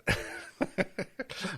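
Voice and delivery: a breathy burst of real laughter. Real, not acted. Host cracking up at the agent's denial, laughing breathily.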